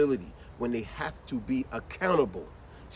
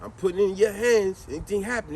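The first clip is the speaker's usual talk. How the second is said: normal talking voice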